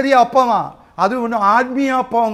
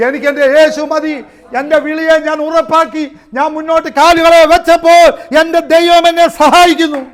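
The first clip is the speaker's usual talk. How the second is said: higher, louder fervent cry; preaching at full force; 8 semitones higher, 10 dB louder than usual. Acted. Preacher declaring his faith to the church, loud and high. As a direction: lift it up, ringing out.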